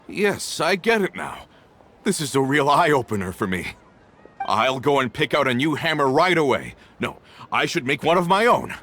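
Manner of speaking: a worker suddenly inspired, eagerly thanking the Archon; excited realization. Acted. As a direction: burst out with new resolve. Sudden insight, eager gratitude.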